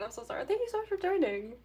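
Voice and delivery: ordinary delivery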